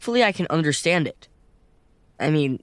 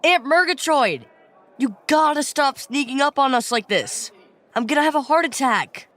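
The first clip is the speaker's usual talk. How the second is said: startled; 7 semitones higher than usual. Acted. Startled by aunt sneaking up.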